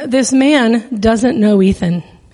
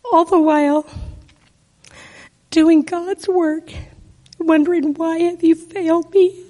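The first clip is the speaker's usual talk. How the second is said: halting, emotional testimony pitched up near tears; choked up; 5 semitones higher than usual. Real, not acted. Painful testimony about her son.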